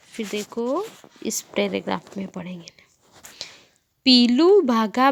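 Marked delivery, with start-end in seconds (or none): 0.1-2.8 softly whispered aside, lower. Real, not acted. Teacher whispers to the children which paragraph to read next. Aside to class while reading.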